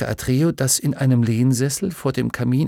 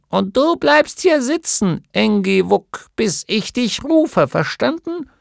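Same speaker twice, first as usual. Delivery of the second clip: put-on much higher, louder, sharply bossy little old woman; domineering gnome wife; 10 semitones higher, 4 dB louder than usual. A narrator's reading. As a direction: put him firmly in his place. (Gnomenweib weist ihren Mann zurecht, viel höher und lauter)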